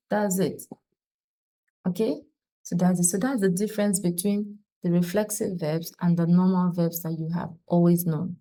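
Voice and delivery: ordinary talk, nothing marked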